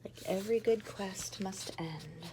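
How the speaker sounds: hushed storybook whisper. Acted. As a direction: whisper it like a secret. Whispered aside.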